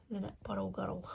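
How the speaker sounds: put-on hushed light English accent on "The Knit Purl Girl". Shown as a demonstration. Knitter testing the designer's name, soft mock English accent. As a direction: try "The Knit Purl Girl" in an English accent, half-whispered through a laugh. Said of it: "Much better in an English accent".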